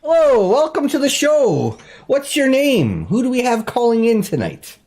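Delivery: upbeat late-night radio host voice; bright, welcoming call-in host; talk show host. Acted.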